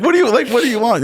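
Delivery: high, loud mock bewilderment; confused carpenters. Carpenters confused by the angry ironworkers, mock bewildered. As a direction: play the baffled carpenters who don't get it. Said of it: "those guys are like".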